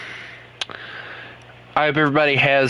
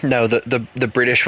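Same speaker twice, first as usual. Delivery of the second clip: ordinary talk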